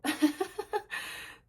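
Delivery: sudden high giggle at her own stumble; real laugh over a tongue twister; high burst of laughter. Real, not acted. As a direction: crack up laughing at the slip.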